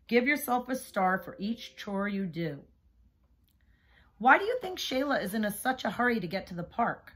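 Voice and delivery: ordinary delivery, nothing marked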